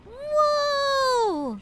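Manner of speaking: surprised shout. Real, not acted. Reacting to game event.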